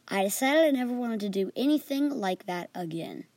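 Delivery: ordinary delivery, nothing marked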